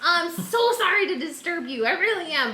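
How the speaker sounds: high, eager, over-apologetic; gushing apology. Acted. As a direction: gush with relief and sorry. Player voices Ned greeting Oberon.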